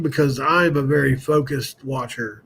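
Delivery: ordinary delivery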